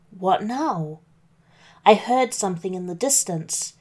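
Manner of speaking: ordinary delivery, nothing marked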